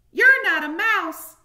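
high, loud squeaky mouse; second mouse. A narrator's reading. Another mouse scoffing at the hamster, loud high squeak. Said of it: "said another".

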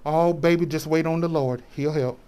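soft, hushed mock comfort; mock soothing. Imitated helper telling baby to wait, mock soothing. Voicing a comforter's empty reassurance.

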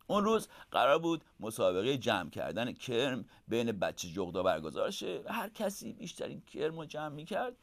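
ordinary delivery, nothing marked

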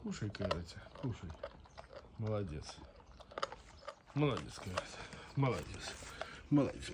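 soft, tender praise; talking to a dog. Real, not acted. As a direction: coax gently, quietly. Softly praising dog eating.

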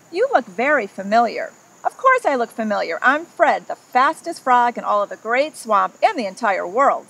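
lively read-aloud storytelling voice; story narration. A narrator's reading. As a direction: read it like a picture book to a child. Reads picture book to children.

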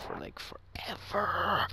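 sheepish whisper, drawn out at the end. Real, not acted. Youtuber admits to viewers he hasn't uploaded, whispering. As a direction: hush it like a guilty confession.

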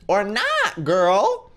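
high, loud sassy retort; emphatic, high-pitched disbelief; sassy exasperation. Real, not acted. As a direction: call her out loudly. Exasperated retort to story character.

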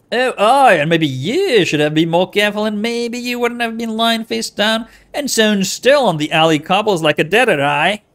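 loud put-on accent, scolding; Anna's made-up accent. Anna scolding you for playing dead, loud put-on accent. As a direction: snap back loudly in a put-on accent. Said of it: "it's her accent".

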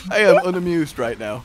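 ordinary delivery

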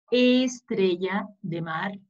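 "estrella de mar" slowly, opening E drawn out; stretched opening E. Shown as a demonstration. Teacher presenting the letter E to children, stretched "estrella de mar". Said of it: "recordarnos nuestra letra E".

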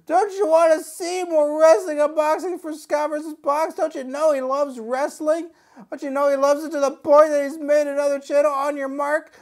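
high, strained voice pleading in protest; upset figure. Pretend. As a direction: keep up the hammed-up upset wrestler. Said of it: "he's upset".

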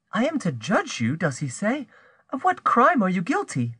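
high-pitched, puzzled feminine voice; bewildered woman. Acted. Bertrande finds Rose weeping at her door, bewildered. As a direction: question her in high, wondering tones.

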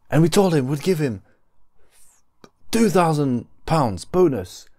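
high, hyped-up vlogger opening; YouTuber intro voice. Pretend. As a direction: sell it like a clickbait video intro. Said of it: "one of those, you know, openings".

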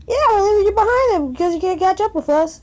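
high, fast gleeful taunt. Real, not acted. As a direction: rub it in, quickly and gleefully. Taunting teammate, faster, higher.

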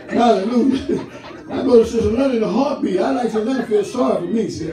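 ordinary delivery

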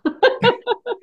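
laughs at her own joke; high burst of laughter. Real, not acted. Co-host laughing at her own cringe joke, high.

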